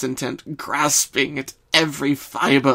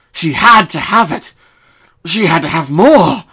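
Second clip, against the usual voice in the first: louder, urgent insistence; much louder narration, frantic craving; hungry craving; 5 semitones higher, 13 dB louder than usual. A narrator's reading. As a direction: lean into the hunger. Craving climax.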